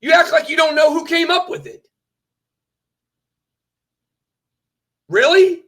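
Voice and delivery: loud, high-pitched indignant complaint; indignant disbelief. Real, not acted. Streamer confronts friend who withheld credit, indignant and loud. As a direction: call him out, loud and high.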